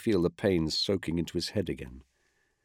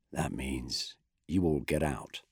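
low, slow, softer weary commander; Brel, low and slow; 6 semitones lower, 7 dB quieter, slower than usual. A narrator's reading. Commander clearing the room, low slow softer voice. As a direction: drop it down, slow and tired.

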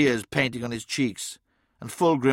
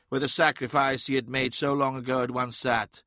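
ordinary delivery

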